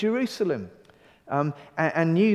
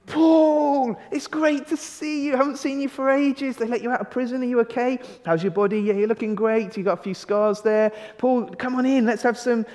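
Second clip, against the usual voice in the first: much higher, eager welcoming voice; Philemon overjoyed; 7 semitones higher than usual. Retold or imitated. Philemon greeting Paul at his front door, high and overjoyed. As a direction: gush like an old friend at the door. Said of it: "Philemon would be like".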